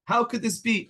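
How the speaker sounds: pained outrage toward heaven; outraged. Retold or imitated. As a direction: cry out to heaven in protest. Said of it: "we need to be also outraged".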